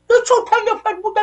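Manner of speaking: high, fast mocking voice of the official; sneering high-pitched mimicry, rattled off fast; mocking quote. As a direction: parrot him in a high, quick sneer. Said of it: "میاد میشینه میگه".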